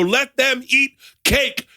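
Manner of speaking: slow, high, lightly voiced haughty queen; a hint of a lofty French queen; Marie Antoinette. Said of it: "to be Marie Antoinette and go".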